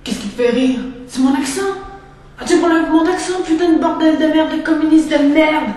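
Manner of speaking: loud, offended tirade against a mocked accent; shouting furious swear-filled rant; angry outburst. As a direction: blow up at the one who mocked you.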